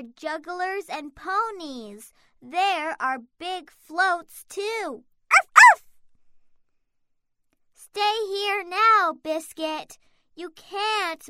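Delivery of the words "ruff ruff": puppy barking. Acted. Puppy barks.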